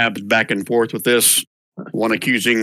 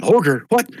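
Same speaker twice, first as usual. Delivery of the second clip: rising, shrill panicked crowd voices; alarmed crowd; 13 semitones higher, 3 dB louder than usual. Acted. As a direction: gasp it around the crowd in alarm. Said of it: "you hear lots of whispers and shouts".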